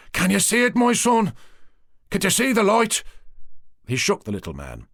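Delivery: high, loud urgent pleading; fervent priest. Acted. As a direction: press him to see the light. Priest urging the cacique.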